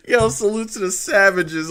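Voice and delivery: loud, high shout-out; hyped shout to the Savages; laughing shout. Real, not acted. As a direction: call out to the fans, laughing. Cracking up, shouting out fans.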